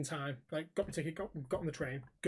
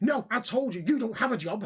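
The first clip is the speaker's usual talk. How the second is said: higher, louder flat refusal to listen; retold angry insistence; 5 semitones higher, 4 dB louder than usual. Stranger insisting the walker has no job, mock-angry. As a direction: imitate a stubborn, indignant accuser.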